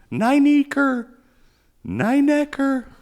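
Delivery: "Nine Acre" said high and loud, drawn out; careful enunciation. Shown as a demonstration. Trying to say the shooter's name right, enunciating "Nine Acre" high. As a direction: pronounce "Nine Acre" high and slow, every syllable clear. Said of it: "enunciate because it's a name".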